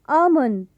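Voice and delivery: आलमंड as AA-mun, no L; आलमंड with a long "aa" straight into M; silent L. Shown as a demonstration. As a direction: drop the L in आलमंड. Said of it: "silent होता है".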